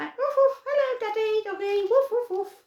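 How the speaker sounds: high, playful little dog greeting; young dog voice hello; puppy voice. Pretend. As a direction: pretend the small dog greets its daddy. Voicing son dog to Daddy.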